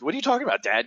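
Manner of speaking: high-pitched imitation of amazed sons; disbelieving, astonished question; incredulous kids. Sons hearing dad's news, incredulous.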